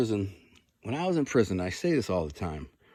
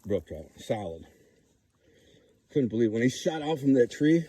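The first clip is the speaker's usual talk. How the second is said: ordinary delivery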